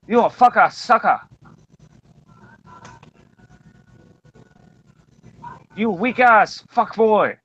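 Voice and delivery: hammed-up street tough talking trash; mock tough-guy threat. Mocking a tough guy taunting his enemy, put-on thug voice. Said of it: "me, I say".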